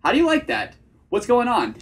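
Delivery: "that" and "on" brought down firmly, like statements; attractive hard-period tonality. Shown as a demonstration. Coach shows his dating delivery, confident falling endings. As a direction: close "What's going on" on a firm falling note. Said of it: "way more attractive vocal tonality".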